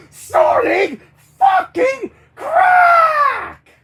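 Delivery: very high, slow full-force scream; screamed outburst. Acted. Character rants about taking drugs, drawn-out screaming. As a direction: scream each word, drawing out the last.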